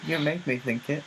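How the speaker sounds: mock indignant.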